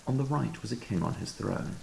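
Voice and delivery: usual speaking voice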